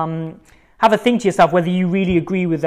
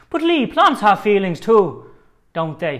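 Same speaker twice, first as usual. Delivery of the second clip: hammed-up Irish lilt for the quote; Irish accent; 3 semitones higher, 5 dB louder than usual. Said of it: "let's do it in an Irish accent".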